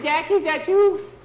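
high, loud plea; frightened question. Acted. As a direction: ask in a panic who is there. Grabbed boy checks it is friend.

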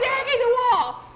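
very high, loud shriek; alarmed yell. Acted. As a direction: scream a startled warning to your friend. Boy alarmed as wall opens.

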